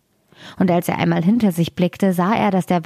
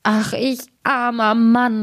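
slow, higher-pitched storybook wail; lamenting cry; 4 semitones higher, slower than usual. A narrator's reading. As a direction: stretch out the woe, mock-tragic. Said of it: "rief er".

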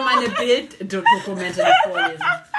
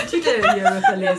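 laughing; 13 semitones higher, faster than usual. Real, not acted. Cracking up before retake.

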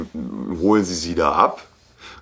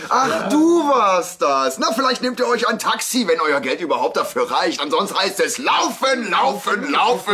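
arrogant boss voice; 11 semitones higher than usual. Retold or imitated. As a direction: sneer it down like a smug boss. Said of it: "Mit welchen Worten nochmal?".

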